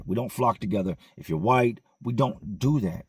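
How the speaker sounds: normal talking voice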